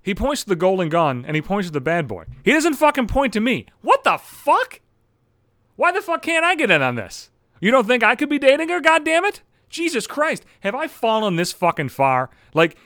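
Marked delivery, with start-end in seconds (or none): 3.8-12.3 high, loud exasperated complaint; pitched-up comic indignation; indignant outburst. Retold or imitated. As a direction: rant as if insulted by being overlooked. Mock outrage at being left out.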